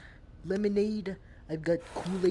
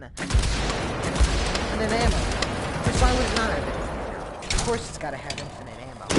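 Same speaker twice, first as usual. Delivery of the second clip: loud, fast, indignant ranting; 3 semitones higher, 9 dB louder than usual. Real, not acted. Gamer loudly ranting to viewers about infinite ammo. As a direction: belt it out like an outraged complaint.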